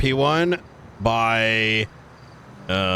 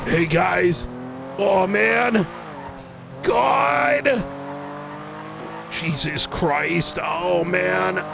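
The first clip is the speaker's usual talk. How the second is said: Brian impression; 3 semitones higher, much slower than usual. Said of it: "I can go from Brian to".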